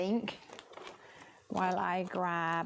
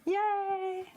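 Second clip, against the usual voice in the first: high, drawn-out yay; high-pitched celebratory squeal of a word; happy cheer; 12 semitones higher than usual. Real, not acted. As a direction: cheer for a friend's first try. Cheers a viewer's first inking.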